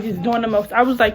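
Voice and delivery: usual speaking voice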